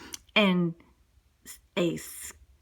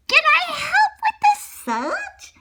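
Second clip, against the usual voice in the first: high, loud, drawn-out owl voice; slow high-pitched little owl; helpful young owl; 5 dB louder, slower than usual. Acted. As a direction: offer help as a high hooty owlet. Young owl offers help.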